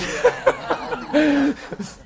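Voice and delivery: loud high laugh; real amused laugh. Real, not acted. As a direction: let out a big laugh. Laughs after Bible joke.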